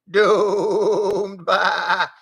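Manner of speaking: doomed stretched into a goat bleat. A narrator's reading.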